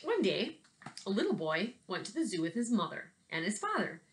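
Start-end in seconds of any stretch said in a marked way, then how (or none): none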